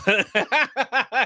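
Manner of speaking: a burst of happy laughter; delighted laugh. Real, not acted. Laughs at his scale weight.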